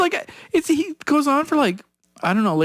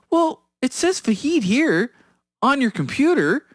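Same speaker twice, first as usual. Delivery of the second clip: imitated old lady, unhurried and pitched up; little old lady; 6 semitones higher than usual. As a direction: play an old lady innocently pointing something out. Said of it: "changes his voice to a little old lady's voice".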